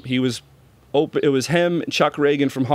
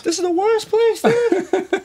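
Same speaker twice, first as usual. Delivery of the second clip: mock outrage; 3 dB louder, slower than usual. Mock outrage over El Cajon.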